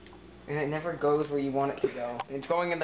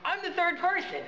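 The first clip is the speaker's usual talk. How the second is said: high silly voice; 12 semitones higher than usual. Pretend. New high-voiced character enters.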